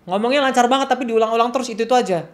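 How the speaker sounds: high, loud run held up throughout; acted exclamation. Observer noting a child's fluent but repetitive talk, acted exclamation. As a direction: call it out as a striking observation.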